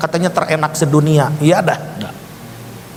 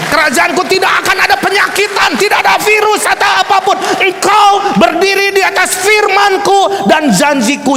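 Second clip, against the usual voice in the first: high shouted prophetic climax; impassioned proclamation; 13 semitones higher, 4 dB louder than usual. Retold or imitated. Preacher proclaiming God's promise to the congregation, shouting. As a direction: preach it at full force, pitched way up.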